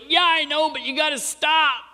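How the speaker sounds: put-on very high, protesting child's whine; exasperated kid. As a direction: beg off like a smothered kid. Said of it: "the kid's like".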